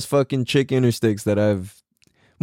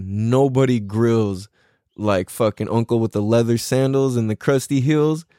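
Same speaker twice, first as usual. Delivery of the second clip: ordinary delivery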